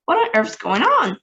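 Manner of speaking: startled president demanding answers; alarmed president. A narrator's reading. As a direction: president hearing the screams, alarmed. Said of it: "said the president".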